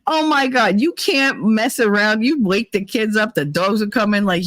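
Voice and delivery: usual speaking voice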